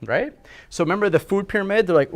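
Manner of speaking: ordinary delivery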